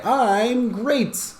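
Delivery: put-on high child-like answer; student answer. Student answering the class, high and bright. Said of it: "And they say".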